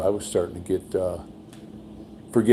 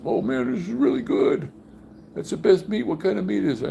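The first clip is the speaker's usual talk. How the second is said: high-pitched, enthusiastic imitation of an eater; delighted guest; 13 semitones higher than usual. Buddy praising Thanksgiving meat before learning what it is, delighted. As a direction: rave in a much higher voice.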